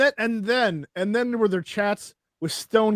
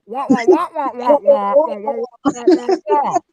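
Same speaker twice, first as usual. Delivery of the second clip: muffled trombone-like wah wah; mock Charlie Brown teacher mumble; Charlie Brown adult wah wah; 3 semitones higher, 6 dB louder than usual. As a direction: imitate the unintelligible Peanuts teacher. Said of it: "it sounded like Charlie Brown".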